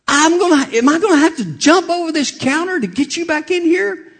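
high, loud mock threat; imitating a woman's loud, high-pitched call; loud receptionist. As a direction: holler it across the counter.

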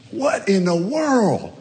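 high, slow, stretched cry of terror; panicked scream. Retold or imitated. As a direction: wail it out as you burn. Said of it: "that he screams".

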